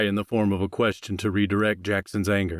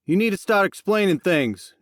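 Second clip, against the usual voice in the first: demanding; 10 semitones higher, 4 dB louder than usual. Acted. Jackson confronts Max.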